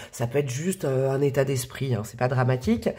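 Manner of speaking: usual speaking voice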